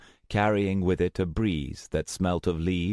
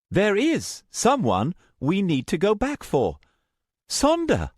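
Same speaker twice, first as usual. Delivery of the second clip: higher, louder pleading voice; pleading young woman; 14 semitones higher, 4 dB louder than usual. Acted. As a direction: plead for the friend left behind. Luna pleads.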